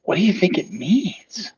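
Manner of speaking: conspiratorial whisper. Acted. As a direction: lean in and whisper it. Overheard playgoer asks in hushed tones.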